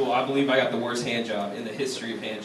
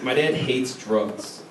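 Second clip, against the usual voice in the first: normal talking voice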